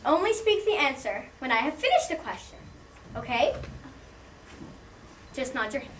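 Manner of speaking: ordinary delivery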